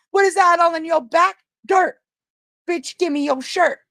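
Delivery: high, loud demanding voice; mock pushy stranger. Acting out a stranger demanding her shirt, loud and high. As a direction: bark it like a rude stranger.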